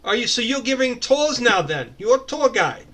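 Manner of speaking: high, loud, mocking accented voice; mock them in an over-the-top accent; David Lopan. Tanema needles the new tour guide, sarcastic and over the top. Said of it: "sarcastic, over-the-top accent of David Lopan".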